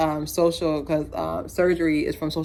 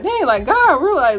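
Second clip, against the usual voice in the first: amazed; 10 semitones higher, 5 dB louder than usual. Retold or imitated. Acting out awe at beautiful people.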